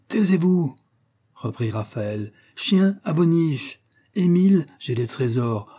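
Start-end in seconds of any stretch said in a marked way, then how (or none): 0.1-0.7 much higher-pitched cry for silence; exclaimed command. A narrator's reading. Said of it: "les exclamations de Raphaël". 2.5-5.7 put-on high-pitched, imperious drunken outburst; drunken exclamations. A narrator's reading. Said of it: "les exclamations de Raphaël".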